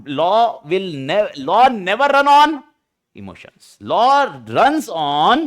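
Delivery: every word of law never run on spaced out, very slowly; slow emphatic rule. Shown as a demonstration. Teacher drilling the class slowly that law runs on facts. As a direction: spell out the principle word by word. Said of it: "teacher stressing the rule".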